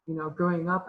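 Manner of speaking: usual speaking voice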